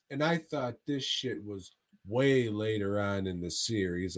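ordinary talk

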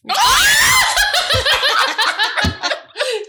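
loud high-pitched cackle; shrieking laugh. Real, not acted. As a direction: burst into a squealing laugh. Big laughing burst at failed attempt.

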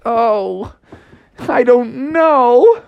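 put-on high, drawn-out kid wail; hammed-up helpless whining; despairing whine. Froggy despairing to his mom.

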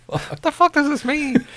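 high, baffled squeak; disbelief, voice jumps way up; incredulous. Real, not acted. Baffled by lyrics.

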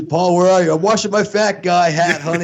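comic high-pitched couple bit; mock domestic call-out. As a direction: call across the house, hammed up. Acting out home life as Homer.

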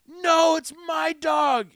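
high, loud, very slow insistent yell; retold shout. Crackhead guy yelling back over the dog, loud slow shout. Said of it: "he's like".

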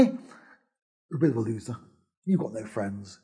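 scornful. Pretend. Voicing the scornful judgement.

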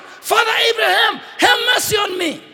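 high, loud plea for mercy; crying out. Acted. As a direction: cry out desperately to a patriarch. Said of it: "He cries out".

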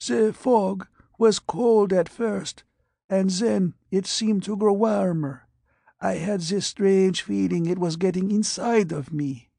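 put-on high, frail old man's voice; withered dying patient. Blind radiation patient answers the doctor, high and frail. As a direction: rasp it out like a man aged beyond his years.

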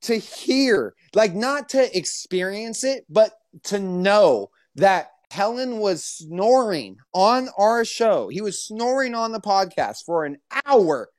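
high-pitched indignant rant; exasperated. Real, not acted. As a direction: draw out key words in outraged disbelief. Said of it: "how mad I was".

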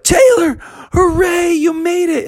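very high, thrilled cheer; overjoyed. Acted. Friends cheer on finding Taylor, very high and thrilled. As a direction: squeal with relief at finding him.

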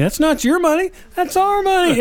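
high-pitched mock indignation; acted protest, pitched well up; imitated protesting scammer. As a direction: mimic an upset scammer, voice climbing.

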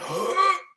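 a sharp, very high intake of breath; startled gasp. Retold or imitated. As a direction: gasp like the alarm just went off. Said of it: "and I went".

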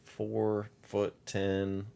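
drawn out, each number lingering; a caller slowly giving a missing woman's size; slow deadpan. Retold or imitated. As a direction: spell it out slowly as if it's normal. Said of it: "She's like".

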